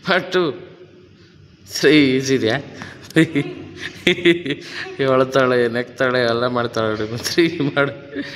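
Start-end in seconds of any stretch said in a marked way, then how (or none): 3.1-4.9 real high giggle, falling away; amused giggle. Real, not acted. Coach amused at the crying child, giggling. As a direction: giggle helplessly at the little pupil.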